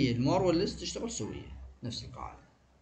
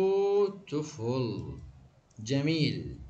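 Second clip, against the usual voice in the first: بيوتيفول stretched slowly, syllable by syllable; slow syllable count; much slower than usual. Shown as a demonstration. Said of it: "كم مقطع؟". (نطق بطيء لكلمة بيوتيفول مقطعاً مقطعاً)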